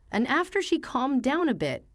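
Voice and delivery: ordinary delivery, nothing marked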